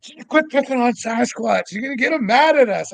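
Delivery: high, fast fretful voice of Darcy; worried Darcy. Retold or imitated. Darcy voiced scolding him.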